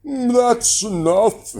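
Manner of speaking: sleepy giant yawning out his words. A narrator's reading. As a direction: say it through a big yawn. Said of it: "he yawned at last".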